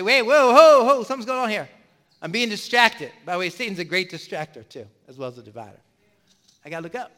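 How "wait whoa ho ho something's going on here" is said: loud, higher startled whoa, catching himself. Retold or imitated. Preacher catching himself being distracted, loud startled whoa. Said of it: "I gotta look up and say".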